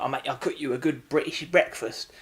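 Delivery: mock British accent slipping towards Australian; bad British accent. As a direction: imitate an actress's wobbly British accent. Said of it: "trying to do a British accent".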